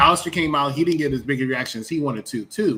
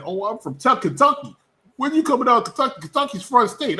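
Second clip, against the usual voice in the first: mocking Kentucky fan; 10 semitones higher, 3 dB louder than usual. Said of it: "they said".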